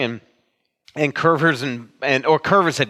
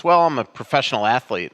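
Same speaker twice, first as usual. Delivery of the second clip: normal talking voice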